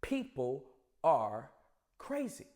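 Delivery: spaced out word by word, chest puffed. Shown as a demonstration. Host repeating his point to the audience, slow and chesty. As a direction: drag each word out proudly. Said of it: "I'ma say it slower with my chest out".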